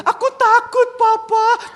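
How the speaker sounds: put-on very high, loud little-boy wail; pleading child. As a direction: beg dad in a hammed-up toddler voice. Frightened son.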